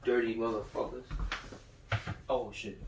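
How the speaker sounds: ordinary talk, nothing marked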